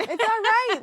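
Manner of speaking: high laughing burst. Real, not acted. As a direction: laugh through the words. Laughing after a joke.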